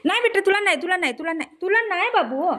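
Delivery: high, gentle no for the pet; pet talk refusal. Real, not acted. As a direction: coax the pet away from the food, high.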